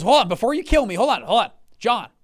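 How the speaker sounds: high, loud, hurried plea to wait; urgent placating. Retold or imitated. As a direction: act out fending off an angry partner. Partner fends off angry dad.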